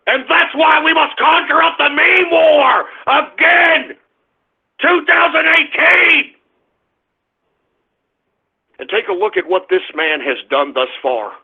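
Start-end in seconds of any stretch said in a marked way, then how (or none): 0.1-6.3 high, impassioned battle cry; rousing call to arms; rallying shout. Real, not acted. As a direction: shout it to the crowd, stretching the ending. Rallying followers to meme war.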